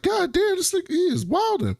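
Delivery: high-pitched, amazed retold reaction; mock shock. Podcaster recalling own reaction to Adler's outburst, astonished.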